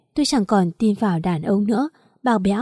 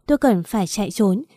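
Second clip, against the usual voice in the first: plain reading voice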